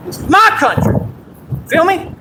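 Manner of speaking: high, loud patriotic shouting; loud and proud. Real, not acted. Streamer shouting at his chat, loud and proud. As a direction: belt it out like a rally cry. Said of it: "Say it loud and proud".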